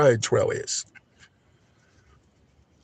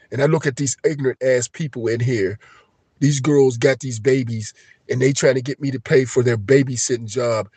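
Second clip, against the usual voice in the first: ordinary talk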